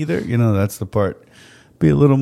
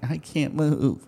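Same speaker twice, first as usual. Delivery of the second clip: pleading, higher and softer mock whine; pitiful sick complaint; 4 semitones higher, 6 dB quieter, much slower than usual. Sick husband answering his unsympathetic wife, pitiful mock complaint. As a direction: play up being too sick.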